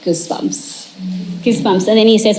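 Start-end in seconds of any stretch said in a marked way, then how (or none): 0.0-0.9 whispered, barely voiced; hushed. Patient feeling the release after breathing, hushed whisper. Patient's awed reaction, acted out.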